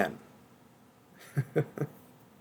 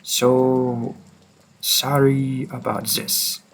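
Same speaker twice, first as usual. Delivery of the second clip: put-on French accent. Hunter forcing the listener to come along, French accent. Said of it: "tried to do a French accent".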